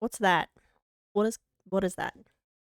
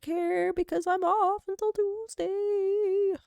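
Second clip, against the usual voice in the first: high, slow sing-song; airy mock nonchalance; sing-song carefree; 14 semitones higher, much slower than usual. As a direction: lilt it like you couldn't care less. Carefree about work voicemail.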